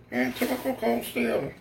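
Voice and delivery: high-pitched mock complainer voice; mocking critic. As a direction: mimic a griping commenter way up high. Mocks a critic, then "Shut up.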